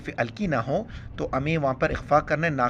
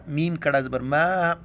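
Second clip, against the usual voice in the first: ordinary talk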